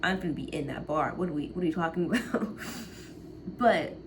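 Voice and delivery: ordinary delivery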